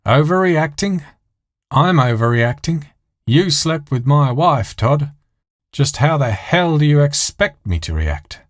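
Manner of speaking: hammed-up soap outrage. Soap opera dialogue on TV.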